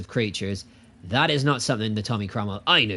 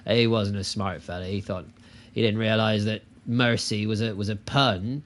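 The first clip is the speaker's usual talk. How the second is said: ordinary talk, nothing marked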